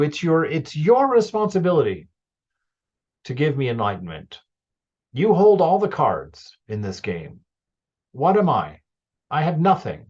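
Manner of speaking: loud, high, insistent challenge; demanding with oomph. Pretend. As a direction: stand up and argue your case to God. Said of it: "get some oomph in there".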